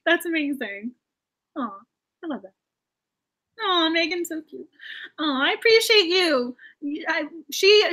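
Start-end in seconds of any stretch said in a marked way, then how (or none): 5.2-7.9 halting, slow and teary; real tearful stammer; choked up. Real, not acted. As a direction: stumble as emotion rises. Moved by a viewer's kind words.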